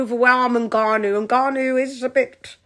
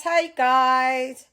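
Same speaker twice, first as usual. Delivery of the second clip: usual speaking voice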